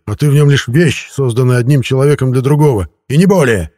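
loud, forceful put-down; growled retort. A narrator's reading. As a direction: hammer it home angrily. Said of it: "прорычал я".

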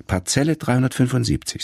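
a hint of a schoolgirl rattling off her address; little girl voice. A narrator's reading. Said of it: "sagt sie". (leicht gespieltes Kind, höher und schnell)

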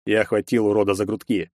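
ordinary delivery

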